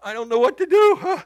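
loud, high helpless outburst; acted fluster. Shaken preacher to the staff, acted fluster. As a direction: blurt it out, hammed-up and rattled. Acting out being rattled.

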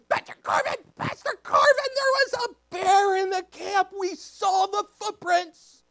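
very high, loud junior-high voice; imitated excited kids. Junior highers reporting a bear to pastor, excited imitation. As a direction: squeal the big news like breathless kids.

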